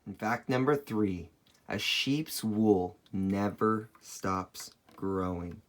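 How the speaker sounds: ordinary talk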